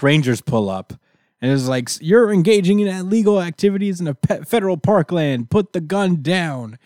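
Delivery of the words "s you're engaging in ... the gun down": higher, brisk ranger warning; higher official ranger voice. Retold or imitated. Park ranger ordering the armored killer to drop the gun, higher official tone. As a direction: lay down the law like a park ranger.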